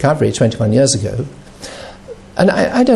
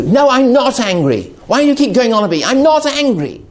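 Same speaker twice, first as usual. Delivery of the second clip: higher, insistent denial that he's angry; 8 semitones higher than usual. Retold or imitated. Addict denying anger to someone who keeps asking, pitched-up irritated insistence. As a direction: snap back that you're fine, clearly irritated.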